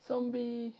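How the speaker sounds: ordinary talk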